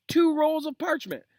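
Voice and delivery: very high, loud squeal; squealing outraged student. Acted. Student protests Snape's homework to Lupin, squealing. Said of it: "explosion of complaints".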